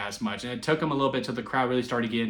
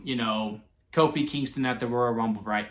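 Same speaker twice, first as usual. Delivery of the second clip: normal talking voice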